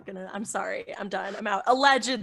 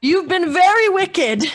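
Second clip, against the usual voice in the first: slow, higher and louder, pretend stern; mock scolding; 6 semitones higher, 8 dB louder, slower than usual. Playfully scolding the roommate's cat on camera, slow mock stern. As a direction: scold the naughty cat in jest.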